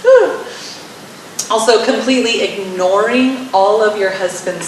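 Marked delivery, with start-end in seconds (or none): 0.1-0.4 a high, loud, cringing ooh; mock squeamish ooh. Speaker cringing at her own advice, with a high loud ooh.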